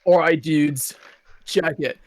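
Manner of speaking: laid-back SoCal surfer dude; socal accent. Acted. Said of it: "egregious Crush voice surfer pun".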